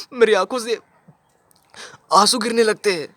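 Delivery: high-pitched acted tearfulness; tearful father. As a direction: plead as if tears are coming. Father claims tears for beloved son.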